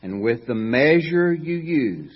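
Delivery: slow, measured reading of scripture. Acted. Preacher reading Matthew aloud to congregation, slow and solemn. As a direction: read the verses slowly and solemnly.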